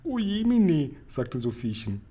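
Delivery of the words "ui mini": put-on high, small girl's exclamation; staunendes kleines Mädchen. A narrator's reading. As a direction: squeak it out in a much higher child's voice. Frightened girl exclaims to giant. (hoher Ausruf eines kleinen Mädchens)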